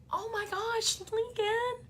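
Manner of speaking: very high, soft melting coo; touched, tender. Retold or imitated. Mother touched by son's thanks.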